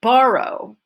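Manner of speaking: borrow said loud and high; stress on BORROW. Shown as a demonstration. Teacher names the new focus word, loud stress on borrow. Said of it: "is my new focus word".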